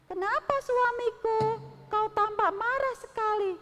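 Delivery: high, sweet queen asking her husband; queen's voice. Acted. Queen to her sulking husband, a high sweet voice. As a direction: coax the sulking king gently.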